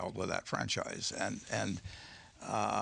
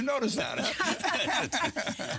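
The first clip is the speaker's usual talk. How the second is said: high, laughing tease; teasing chuckle; 13 semitones higher, much slower than usual. Real, not acted. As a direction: poke fun with a chuckle.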